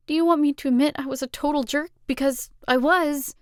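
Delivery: high, urgent apology; pleading. Acted. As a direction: plead for forgiveness.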